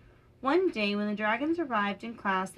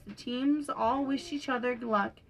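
plain reading voice

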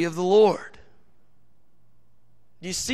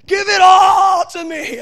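high, loud and slow, voice straining for it; hungry shout; 8 semitones higher, 8 dB louder, slower than usual. Retold or imitated. Moses pleading to see God's glory, loud hungry cry. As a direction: demand everything with raw longing. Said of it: "He's, like, really hungry".

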